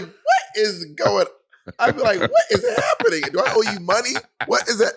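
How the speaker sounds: high-pitched, incredulous outburst; comic high 'what is happening?!' disbelief; mock bewilderment. Imagined shocked reply to a queen.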